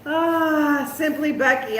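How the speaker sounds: ordinary delivery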